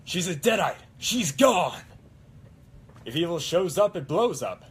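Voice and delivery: Ash. Acted. Cosplayer showing best Ash impression on request, loud heroic voice. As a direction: belt out the movie-hero one-liners. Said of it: "best Ash impression right now".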